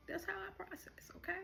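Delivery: high, hushed, breaking voice; tearful. Real, not acted. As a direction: squeeze it out softly through tears. Still emotional, repeats it brokenly.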